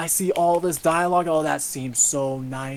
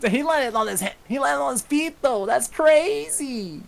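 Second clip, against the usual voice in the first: much higher, stretched mock disbelief; mock amazement; 11 semitones higher than usual. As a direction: gush in fake astonishment.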